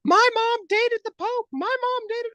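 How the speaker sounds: very high squealed mock boast; squeaky, high-pitched mimicry of people bragging; imitating excited gossipers. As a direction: put on a tiny high voice. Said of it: "certain people are like".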